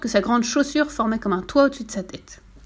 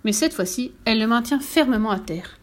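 ordinary delivery, nothing marked

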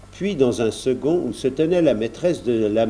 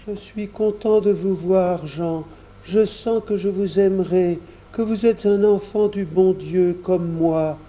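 put-on sick child Roger; 7 semitones higher than usual. A narrator's reading. Narrator voices sick boy Roger.

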